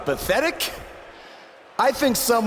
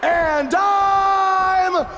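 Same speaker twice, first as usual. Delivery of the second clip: mock Miz shout; 9 semitones higher, much slower than usual. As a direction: bellow it like a gloating heel. Mocking Miz's catchphrase to crowd.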